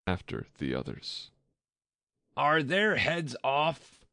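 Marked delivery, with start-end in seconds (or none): 2.4-4.0 high, drawn-out shouted question; queen's high, slow holler. A narrator's reading. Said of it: "shouted the queen".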